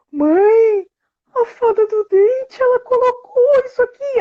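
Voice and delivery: put-on high, slow, awestruck little girl; thrilled child. Daughter amazed, telling mother the tooth fairy left this. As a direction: gasp out the discovery.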